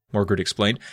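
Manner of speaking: usual speaking voice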